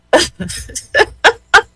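high-pitched peals of laughter; real hearty laughter. Real, not acted. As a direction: crack up completely. Laughing at the child's prayer.

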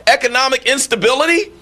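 radio host railing in disbelief at a fake identity; incredulous outrage. Real, not acted. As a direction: pitch it up in disbelief at the absurdity. Incredulous rant at the impostor.

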